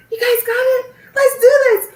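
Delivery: high, loud pep-talk cheer with a laugh; rally the class like an overexcited teacher; a laughing, shouting burst of classroom pep; energetic teacher cheer. Retold or imitated. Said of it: "why is she so energetic".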